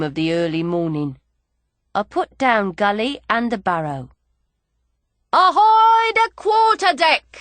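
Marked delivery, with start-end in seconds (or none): 5.3-7.3 high, loud call up to the ship's deck; shouted hail. Acted. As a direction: hail the quarterdeck at full voice. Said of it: "I shouts up".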